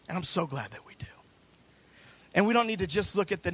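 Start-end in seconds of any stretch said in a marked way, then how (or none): 0.1-1.1 soft, near-whispered gratitude; hushed aside. Real, not acted. Preacher glad of the power of life, hushed aside. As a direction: drop to a whisper after the climax.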